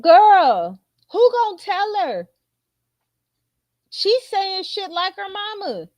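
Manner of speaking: high-pitched, loud-starting disbelief; hammed-up incredulous outcry; retold hollering. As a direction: holler it in mock dismay, then ease off. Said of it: "I hollered".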